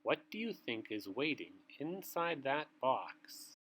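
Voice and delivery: ordinary delivery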